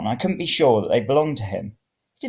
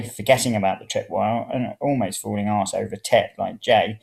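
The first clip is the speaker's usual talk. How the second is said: usual speaking voice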